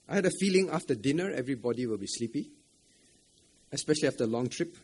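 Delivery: normal talking voice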